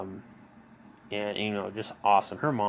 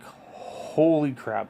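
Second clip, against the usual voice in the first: stretched-out, higher cry of pain; pained exclaim; 6 semitones higher, 4 dB louder, much slower than usual. Retold or imitated. Lying on the floor in pain, a slow pained cry. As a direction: drag out the words as the pain hits. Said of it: "I'm like".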